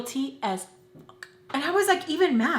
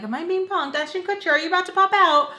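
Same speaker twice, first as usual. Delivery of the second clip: higher, rising louder to the end; excited mock disbelief; 7 semitones higher, 3 dB louder, faster than usual. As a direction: build up the incredulous questions. Acted excited question to camera prank.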